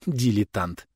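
ordinary delivery

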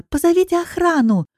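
high-pitched urgent call; crying out. A narrator's reading. Woman being dragged to the parking lot, urgent high cry. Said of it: "кричу я".

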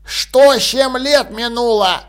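put-on slow toothless old man mumble; mumbling old man. A narrator's reading. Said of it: "прошамкал старик".

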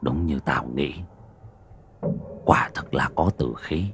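put-on an old man murmuring a grim finding; old village elder. A narrator's reading. (giọng già làng trầm, nhỏ)

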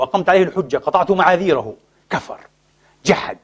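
ordinary delivery

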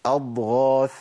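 أضغاث with a full voiced gh; correct ghayn. Shown as a demonstration. Teacher answering what it should be, carefully articulated. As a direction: say أضغاث slowly, no kh in the gh. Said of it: "هي إيه؟".

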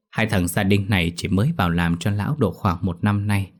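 ordinary delivery, nothing marked

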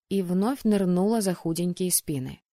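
usual speaking voice

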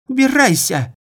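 high, loud screech; shrieked. A narrator's reading. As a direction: scream him out of the room. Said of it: "Девица завизжала".

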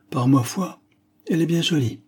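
put-on old man suddenly charmed by the girl; admiring exclamation. A narrator's reading. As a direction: exclaim as if the words escape him. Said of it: "s'écria le père Adams".

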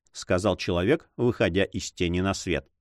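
plain reading voice

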